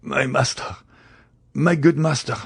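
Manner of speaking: a dying servant's devoted cry; anguished exclamation. A narrator's reading. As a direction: reach out to him in agony.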